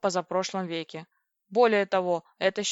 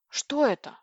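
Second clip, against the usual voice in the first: usual speaking voice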